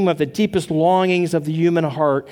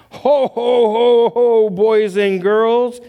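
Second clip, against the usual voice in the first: big booming ho ho ho, louder and higher; bellowing Santa; 5 semitones higher, 5 dB louder, slower than usual. Retold or imitated. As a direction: boom it out like a jolly Santa. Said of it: "he bellowed".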